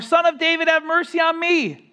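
high, loud desperate cry; high loud cry for mercy. Acted. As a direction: shout it across the crowd. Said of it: "kept crying out all the more".

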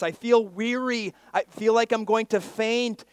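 high, loud cry of weariness; exasperated complaint. Acted. Preacher voices human experience of weariness, loud complaint. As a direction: raise the voice in protest.

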